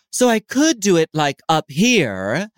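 high, light head voice. Shown as a demonstration. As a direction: lift it up high, off the throat. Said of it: "is this not vocal fry".

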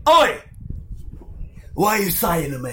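loud, high rough London geezer; London accent. Retold or imitated. Friend doing a rough London accent for a laugh. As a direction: shout it like a London bloke picking a fight.

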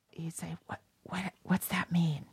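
whispered question, mimicking a child at a show; whispering. Son asks parent during the musical, whispering. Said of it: "I'd whisper into his ear".

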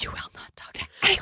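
put-on furious whisper at the kids; angry whisper. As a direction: whisper yelling, hissed and fast. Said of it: "This is my angry whisper".

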